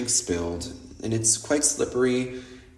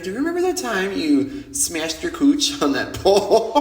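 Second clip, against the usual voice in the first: higher, giggling through the question; laughing tease; 7 semitones higher than usual. Real, not acted. Friends teasing her about the fall, laughing.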